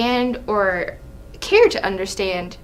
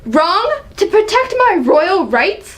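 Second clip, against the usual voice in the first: indignant, pitched up in outrage; angry king; 7 semitones higher than usual. Acted. King berating his son, outraged. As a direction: bristle at being told how to rule. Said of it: "Seeing the angry side of [name]".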